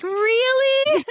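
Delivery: shrieking high and loud in mock disbelief; shocked shriek. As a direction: squeal it like she can't believe it. Retold shocked reply.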